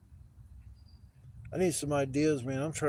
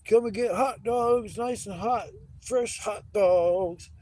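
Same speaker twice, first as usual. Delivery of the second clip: loud, high hot dog hawker's call; yelling vendor; 11 semitones higher, 10 dB louder, much slower than usual. Retold or imitated. Hot dog seller calling out to the arena crowd, yelling. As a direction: holler it out slowly, stretching the words like a stadium vendor. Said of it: "This guy's yelling".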